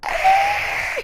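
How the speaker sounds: very high short shriek; imitated sister scream. Sister screaming as the trash can lands in the tub, high shriek. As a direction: let out a startled bath-time scream. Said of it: "hear my sister screaming".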